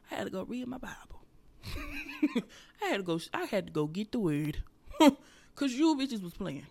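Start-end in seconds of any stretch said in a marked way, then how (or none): 0.1-1.2 soft whispered aside; hushed softer aside. Real, not acted. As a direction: whisper it like a confession. Hushed aside about Bible.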